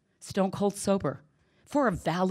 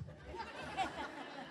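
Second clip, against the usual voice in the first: short high laugh; 8 semitones higher, 11 dB quieter than usual. Real, not acted. Laughs before final story.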